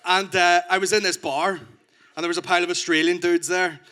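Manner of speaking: ordinary delivery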